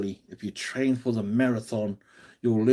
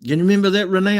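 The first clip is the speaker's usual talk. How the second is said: loud, high call across the room; 7 semitones higher, 8 dB louder, much faster than usual. Retold or imitated. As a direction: shout the question to Renee. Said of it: "so I'd be like".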